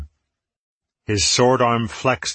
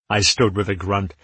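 plain reading voice

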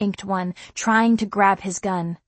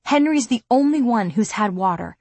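ordinary delivery, nothing marked

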